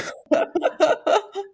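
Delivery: squealing high-pitched giggle; giddy high laugh. Real, not acted. Giggling at handsome guards. (尖声咯咯笑)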